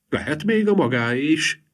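high, playful tease; young wife teasing the lawyer; mischievous. A narrator's reading. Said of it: "csintalankodott a menyecske".

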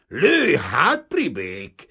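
loud, high start, defiant challenge; teeth-grinding fury. A narrator's reading. Kazimír daring the detective to shoot, grinding his teeth. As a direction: force it out through gritted teeth. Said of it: "csikorgatta Kazimír a fogait".

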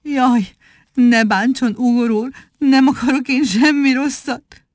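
high, begging plea; pleading. A narrator's reading. Kneeling monkey begs Ugor to spare him. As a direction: plead on your knees. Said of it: "könyörögve mondta".

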